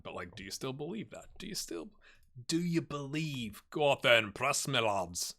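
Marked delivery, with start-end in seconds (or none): 0.0-3.6 hushed, unvoiced dramatic ask; whispering. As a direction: breathe the question like a secret.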